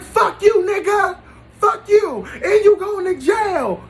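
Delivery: high, loud mocking shout; girlfriend yelling. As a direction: scream it across the court like she did. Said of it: "She was like".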